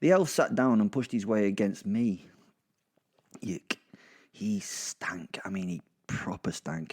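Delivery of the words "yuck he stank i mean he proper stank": whispered aside, hushed and disgusted; confiding whisper of disgust. Acted. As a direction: lean in and whisper the stink. Grossed out aside to listener.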